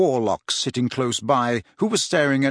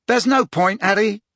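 higher character voice, calling out briskly; Tonks; 8 semitones higher, 3 dB louder than usual. A narrator's reading. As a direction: cut in firmly on someone yelling. Tonks calls to the yelling Harry.

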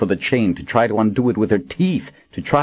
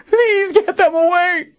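very high, terrified wail; 4 dB louder than usual. A narrator's reading. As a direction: wail in agony and terror. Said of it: "Kara wailed".

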